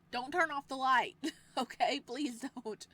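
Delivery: loud, high begging; panicked plea. Acted. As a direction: beg him not to leave you in darkness. Captive begs him to stay.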